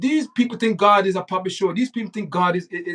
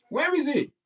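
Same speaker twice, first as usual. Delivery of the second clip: mocking; 10 semitones higher, 4 dB quieter than usual. Real, not acted. Mocking question about God.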